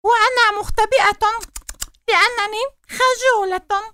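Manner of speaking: shy little mouse. Acted. Shy mouse speaks from hiding.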